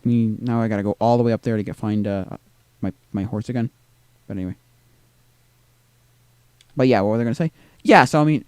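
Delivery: ordinary delivery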